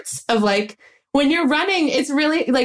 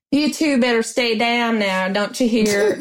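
Southern accent. Shown as a demonstration. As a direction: give the line a homespun Southern twang. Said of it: "if Birdie had been from the South".

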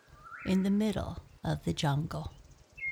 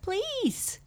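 very high little mouse voice; crying out as mouse. A narrator's reading. Caught mouse begging the lion, crying out. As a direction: squeal a plea as a tiny mouse. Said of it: "cried Mouse".

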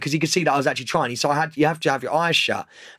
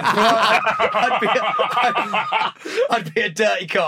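high, laughing, barely getting words out; cracking up, slow and broken; helpless laughing; 6 semitones higher, much slower than usual. Real, not acted. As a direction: push the joke out through real laughter. Cracking up at own dirty cop joke.

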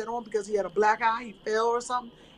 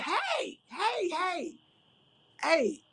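calling out; 7 semitones higher, 4 dB quieter, much slower than usual. Retold or imitated. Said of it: "So I said".

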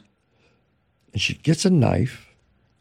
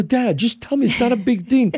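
put-on higher, pleading kid; pleading son; 8 semitones higher than usual. Son begging his dad for the secret, lightly pleading. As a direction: beg dad to finally tell. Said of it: "I go".

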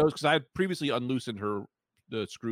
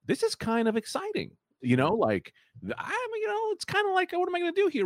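excited. Retold or imitated. Excited inner voice, high.

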